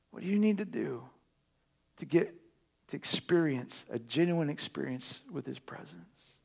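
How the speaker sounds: soft, low, intimate sermon voice; hushed reverent sermon; hushed preaching. Acted. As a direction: draw them in quietly.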